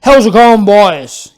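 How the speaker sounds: put-on old cobbler calls out loud to the passing boys; calling out. Cobbler calling out a greeting to the boys. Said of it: "he called".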